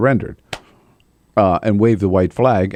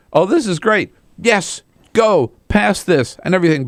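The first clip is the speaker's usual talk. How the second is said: high, hammed-up gushing voice; a teasing caricature of Ted Cruz cheering them on; mock gleeful Cruz; 10 semitones higher, 3 dB louder than usual. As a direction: pitch it way up, fake enthusiasm. Mocking Ted Cruz's glee.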